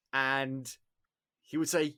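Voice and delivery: ordinary talk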